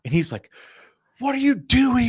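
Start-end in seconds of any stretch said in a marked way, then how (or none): 1.2-2.1 high, loud mock yell; indignant, high-pitched outburst; retold screaming argument. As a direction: imitate an exasperated friend yelling. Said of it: "we're just screaming at each other".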